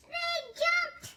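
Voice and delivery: high, bouncy reading of the jump; excited jump. A narrator's reading. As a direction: make the egg leap up. Reading egg jumping, high voice.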